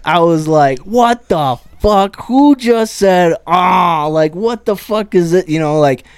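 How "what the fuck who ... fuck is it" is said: retold outburst, higher and drawn out at first; losing it. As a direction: lose your cool at a heckler, half in jest. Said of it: "lost it a little bit".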